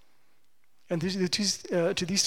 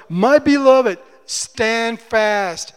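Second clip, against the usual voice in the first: loud, proclaiming "My beloved, stand fast"; ringing quote; 9 dB louder, much slower than usual. Retold or imitated. Pastor reading Paul's appeal, booming. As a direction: call it out over the congregation. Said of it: "Paul uses it in verse one".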